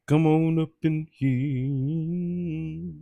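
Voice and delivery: slow, stretched-out come on up in here; slow, sung-out invitation to the chat; drawn-out call. Acted. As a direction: draw every word out slowly like a singsong welcome. Welcoming viewers into the live.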